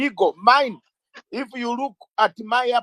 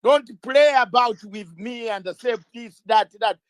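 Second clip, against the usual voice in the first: ordinary delivery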